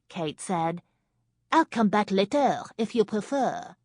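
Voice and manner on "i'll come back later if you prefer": put-on high, loud French-accented command; thick French accent. A narrator's reading. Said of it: "in a French accent as thick as nightclub smoke".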